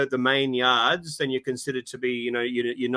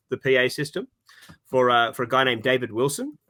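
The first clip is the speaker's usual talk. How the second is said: usual speaking voice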